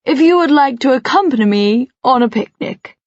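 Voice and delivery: hammed-up posh English gentleman; fake posh voice. Noah in a fake posh accent inviting her to a moonlit picnic. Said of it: "in a fake posh voice".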